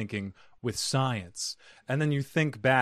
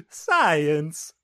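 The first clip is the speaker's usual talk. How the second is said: higher mock Italian teacher accent; 5 semitones higher, 5 dB louder than usual. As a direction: say it like an Italian teacher, playfully put on. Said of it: "was very Italian teacher way".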